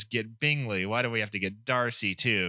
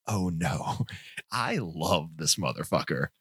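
lower, slower, dreamy mock swoon; lovestruck Elizabeth; 5 semitones lower, slower than usual. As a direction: sigh it out like a girl head over heels. Elizabeth gushing about Darcy.

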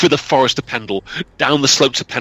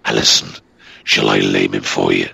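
talking demon dog. Acted. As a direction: drop deep into a menacing beast. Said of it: "and the dog talked".

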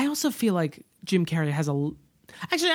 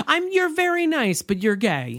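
condescending sweet; 9 semitones higher than usual. Retold or imitated. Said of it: "which is like".

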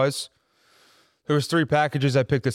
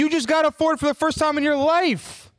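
incredulous. Real, not acted. Friend mocking his brand-new Ford loyalty, high incredulous. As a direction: stretch it out in shocked disbelief. Disbelieving retort in car argument.

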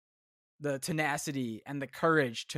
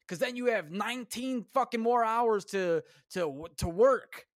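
higher, sneering mock of a hustle guru; mocking influencer; 7 semitones higher than usual. Ranting about rich social media gurus, higher mocking impression. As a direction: ham up a smug hustle-culture preacher. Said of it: "they don't know what the fuck they're talking about".

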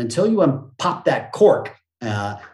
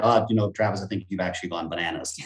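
judgy inner voice; 6 semitones lower, 6 dB quieter than usual. Retold or imitated. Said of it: "a personality part that loves to judge that".

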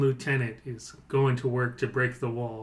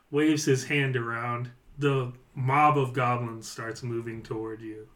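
ordinary delivery

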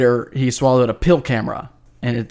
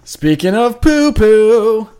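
playful; 7 semitones higher, 4 dB louder, much slower than usual. Acted. Jokey topic change.

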